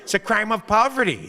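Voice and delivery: judge telling him to calm down. Retold or imitated. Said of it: "they're like".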